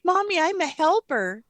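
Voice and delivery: high, proud little helper; excited child. Retold or imitated. Daughter telling mom her news, excited and high. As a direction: beam it out like a proud kid. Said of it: "she was so excited".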